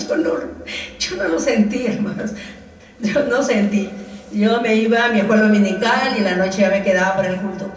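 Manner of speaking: normal talking voice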